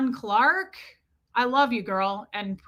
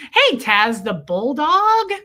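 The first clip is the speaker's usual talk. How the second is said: ordinary talk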